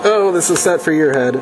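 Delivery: ordinary talk, nothing marked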